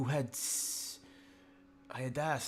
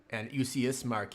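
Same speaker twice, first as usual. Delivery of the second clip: ordinary talk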